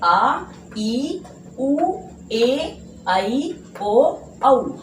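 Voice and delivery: आ, ई, ऊ, ए, ऐ, ओ, औ each drawn out for two beats; long vowels sounded out. Shown as a demonstration. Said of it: "दो मात्रा का समय लगता है". (हर दीर्घ स्वर खींचकर, साफ़ बोलना)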